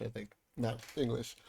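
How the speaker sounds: normal talking voice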